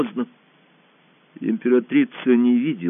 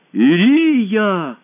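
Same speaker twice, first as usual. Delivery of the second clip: high, loud, long-drawn call; calling out over noise; 12 semitones higher, 7 dB louder than usual. A narrator's reading. Tadeush to his wife over the uproar, calling loudly. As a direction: call the name across a noisy hall. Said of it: "голос Тадеуша перекрыл шум зала".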